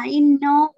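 inno with a loud, round NO; full O sound. Retold or imitated. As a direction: show the mistake, inno ending in a long O. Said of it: "ô ở giữa".

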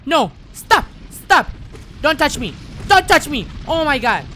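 very high, loud shouts of alarm; panicked screaming. Real, not acted. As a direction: scream at the attacker to back off. Grabbed by game enemy.

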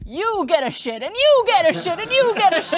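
building, excited Oprah giveaway shout; Oprah impression. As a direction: hype it up like Oprah giving away cars. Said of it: "That's my Oprah".

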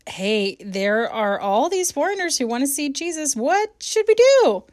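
rising to a high, loud panicky question; anxious mock report. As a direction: play a flustered disciple, voice climbing. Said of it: "says something along the lines of".

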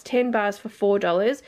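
normal talking voice

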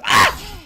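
sharp frightened yelp; startled yelp. Real, not acted. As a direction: jump out of your skin. Jump scare.

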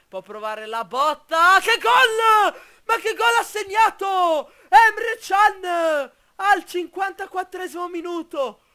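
loud, high, stretched goal scream; breathless italian goal commentary. Acted. Live goal call in game.

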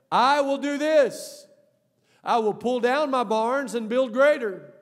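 slow, loud, high proclamation; big preaching voice, drawn out; booming declaration. Acted. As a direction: proclaim it like the rich man's grand resolve. Rich man's resolve, preached.

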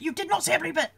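high, exasperated outburst, each word punched; mock-outraged retort; retold heated contradiction. Retold quarrel with mother.

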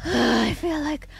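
breathless, sighing whisper; out of breath. Real, not acted. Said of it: "out of breath".

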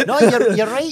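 high, loud laughing mock outrage. Real, not acted. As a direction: laugh through a fake offended protest.

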